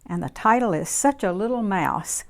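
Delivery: plain reading voice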